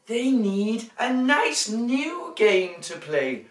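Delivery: bright, lively storybook reading; reading to children. A narrator's reading. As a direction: read it big for little ones.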